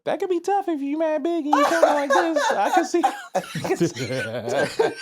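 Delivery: very high mock voice, laughing; squeaky not-deep voice. Shown as a demonstration. Joking about a big man's voice not matching his physique, squeaky. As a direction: pitch it way up like a tiny voice on a big man. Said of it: "My voice ain't deep enough".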